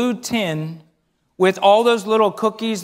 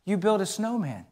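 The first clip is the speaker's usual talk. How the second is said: a hint of a soft old lady's voice, much softer; quoted old lady; 9 dB quieter than usual. Old neighbor noticing the kids' snowman, softly surprised. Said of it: "she looked over our shoulder and she said".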